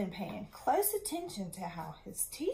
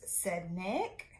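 ordinary delivery

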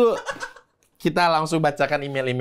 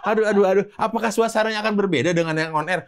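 higher, rapid aduh aduh, laughing; mock flustered excitement; 7 semitones higher than usual. Host giddy over planning a live show. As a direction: flap about giddily at the idea of a show.

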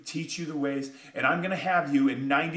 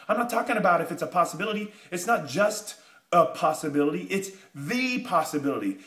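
ordinary talk, nothing marked

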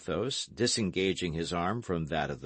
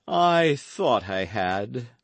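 slow, mock-mournful sigh; melancholy air; 4 semitones higher, 5 dB louder, much slower than usual. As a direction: drag it out like a wounded friend. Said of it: "resuming his melancholy air".

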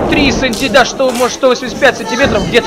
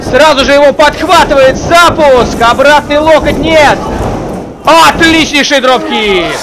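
higher, energetic live play-by-play; wrestling commentator; 4 semitones higher than usual. Real, not acted. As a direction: call each move as it lands.

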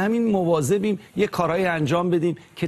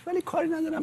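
کاری ندارم said much higher and softer; high, soft quote; 14 semitones higher, 5 dB quieter than usual. Retold or imitated. Hurt believer insisting he wants nothing to do with him, high and soft. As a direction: lift ولی کاری ندارم up high, then hush it. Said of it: "میگه من دیگه".

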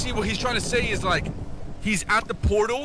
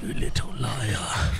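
low, slow drawn-out accusation; mock menace; 9 semitones lower, much slower than usual. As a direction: drag it out low, mock-vengeful. Repeats mock threat after scream.

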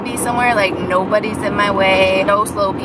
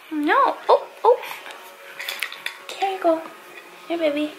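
soothing; 7 semitones higher, 6 dB quieter than usual. Real, not acted. Soothing baby with stuffy nose.

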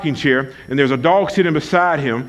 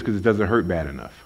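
casual farmer; 11 semitones lower, 6 dB quieter, faster than usual. Retold or imitated. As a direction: shrug it off like it's nothing. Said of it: "the farmer says".

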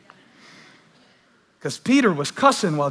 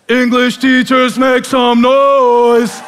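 loud, slow drawn-out crowd call; hype call; 10 semitones higher, 11 dB louder, much slower than usual. Real, not acted. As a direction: rouse the room. Calling the crowd out.

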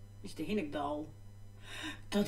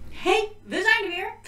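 excited; 11 semitones higher, 5 dB louder than usual. Real, not acted. High cheerful greeting back.

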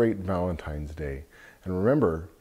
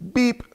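truck beep. Acted. Truck honks in picture book.

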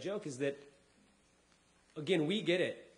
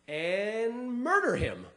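slow deadpan; 6 semitones higher, much slower than usual. Acted. God's plan, drawn out.

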